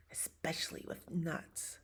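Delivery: sly whisper, soft and secret; hushed pigeon confiding about nuts; whispered aside. Acted.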